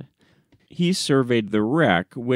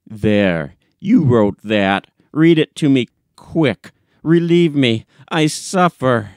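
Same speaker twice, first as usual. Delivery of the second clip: loud, urgent, desperate plea; frantic pleading; 6 dB louder than usual. Acted. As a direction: beg him to read it now, louder. Frantic man begs reading.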